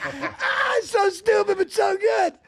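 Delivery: high, loud words through laughter; laughing. Real, not acted. Host laughing over the joke, high and loud. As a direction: still cracking up as you speak.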